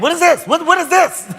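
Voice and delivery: high, indignant outburst; mock mad. Retelling his shock at the video, in high mock outrage. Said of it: "I got mad".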